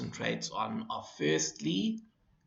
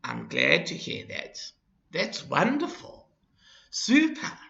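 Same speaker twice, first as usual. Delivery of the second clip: rising, higher delighted praise; happy; 4 semitones higher than usual. Shown as a demonstration. Teacher reading the happy phrases to the class. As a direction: sound pleased for them. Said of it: "three phrases that express happiness".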